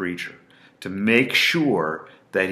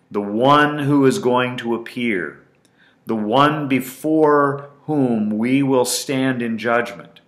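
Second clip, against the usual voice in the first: emphatic scripture reading; 4 semitones higher, 5 dB louder than usual. Shown as a demonstration. Preacher to listeners, reading Paul's charge with strongest emphasis. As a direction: proclaim it with full weight. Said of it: "with the strongest emphasis possible".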